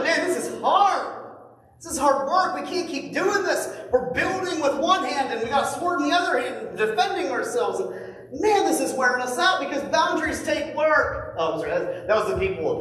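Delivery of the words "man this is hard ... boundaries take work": exhausted complaint. Retold or imitated. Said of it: "people in Judah are like".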